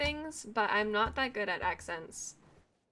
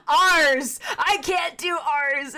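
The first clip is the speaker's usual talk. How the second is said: much louder outburst, slow on the second line; exasperated cry; 7 semitones higher, 9 dB louder, much slower than usual. Real, not acted. Streamer laughing about failing British R's, exasperated cry. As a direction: throw up your hands at the letter R. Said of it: "you can really tell that it is not my accent".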